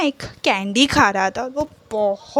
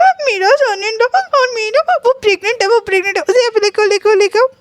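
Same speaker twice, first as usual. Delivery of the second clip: high, loud, laughing excitement; thrilled father-in-law squealing with delight; excited; 11 semitones higher, 5 dB louder than usual. Retold or imitated. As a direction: burst out with joy, giggling. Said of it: "वो बहुत excited".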